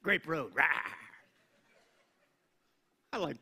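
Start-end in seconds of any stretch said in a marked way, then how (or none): 0.5-0.6 short mock roar to the crowd; playful roar. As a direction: growl a playful "rah" at the room.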